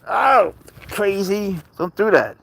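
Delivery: high, slow, gushing fan voice; mock fan reaction. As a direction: gush like a stranger spotting the famous cat.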